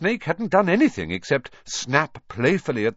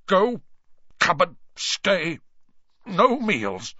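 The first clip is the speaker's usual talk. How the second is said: choked, halting fury, higher; strangled voice; 8 semitones higher, slower than usual. A narrator's reading. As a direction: force out words through rage. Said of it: "so angry he could hardly speak".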